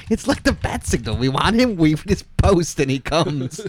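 high-pitched, tickled delivery with laughter around it; high and giddy, on the edge of laughing; amused excitement. Real, not acted. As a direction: pitch it up, giddy at your own joke. Cracking up at own joke.

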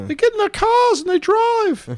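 high British character voice; British accent. Acted. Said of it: "I'm doing it in a British accent".

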